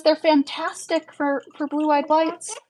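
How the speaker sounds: slow, high mock judge voice; imitating the judge. Streamer mimics the judge's dry verdict, slow and high. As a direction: drag it out high, dry and unimpressed. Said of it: "and he goes".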